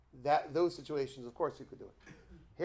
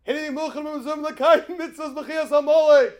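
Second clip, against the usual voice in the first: battle cry; 13 semitones higher, 17 dB louder than usual. Retold or imitated. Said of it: "And he says".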